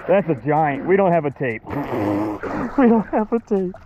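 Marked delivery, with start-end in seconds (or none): 2.8-3.7 excited. Real, not acted. Repeat of line 49, higher.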